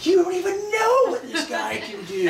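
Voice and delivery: high, loud, laughing fan voice; freaking out. Retold or imitated. Excited fan bragging to the models, high laughing voice. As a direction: gush to the models in a giddy high voice. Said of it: "He just starts freaking out".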